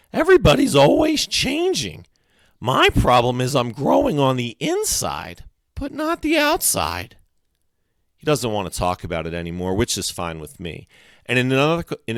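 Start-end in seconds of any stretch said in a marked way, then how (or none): 0.1-7.2 put-on high, loud kid voice; high-pitched, emphatic know-it-all; lecturing boy. A narrator's reading. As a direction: explain it insistently in a high boy's voice. Freak explains as if to slow pupil.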